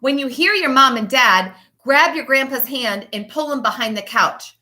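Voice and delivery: usual speaking voice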